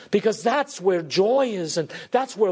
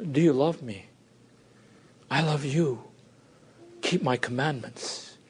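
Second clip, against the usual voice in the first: tender, lowered voice, quiet invitation; sweetly; 5 semitones lower, 4 dB quieter than usual. Retold or imitated. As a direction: softly, lower and gentle, «do you love me». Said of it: "He says, ever so sweetly".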